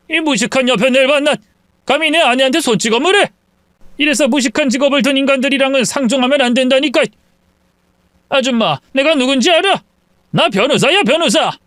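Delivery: lightly voiced yelling in-law; shouting father-in-law. Father-in-law yells at the mother after the slap, mock shout. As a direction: rage at the slap. 소리를 지르더군요.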